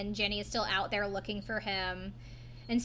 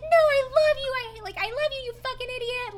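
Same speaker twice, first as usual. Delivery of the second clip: mock shriek; 14 semitones higher, 7 dB louder than usual. Snooki's drunk outburst retold.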